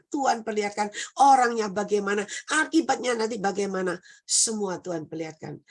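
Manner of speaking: normal talking voice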